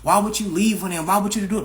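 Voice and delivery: ordinary delivery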